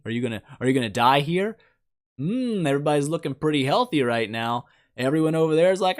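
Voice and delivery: normal talking voice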